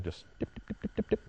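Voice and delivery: soft breathy taps of dip, dip; whispered dips. Shown as a demonstration. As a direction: tick off each tiny zap under your breath. Imitates electric collar taps.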